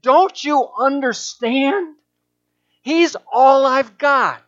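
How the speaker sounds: high, loud, slow anguished plea; impassioned plea. Retold or imitated. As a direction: beg slowly with rising anguish. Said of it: "her impassioned plea".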